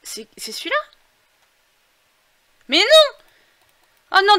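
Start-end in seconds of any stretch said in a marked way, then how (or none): 2.7-4.4 very high, loud shocked no; dismayed cry. Real, not acted. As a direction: cry out in alarm. Perd le personnage de vue. (cri aigu de consternation)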